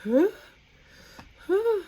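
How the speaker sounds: high, squeaky mock double take; stunned disbelief. Streamer recalling a viewer's compliment, incredulous. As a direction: act out being floored by the compliment. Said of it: "I'm like".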